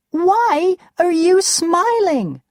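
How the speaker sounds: high, loud indignant demand; angry. A narrator's reading. Roxanne confronts Duncan for smiling, high and angry. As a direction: snap it at him, offended. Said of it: "She's very angry".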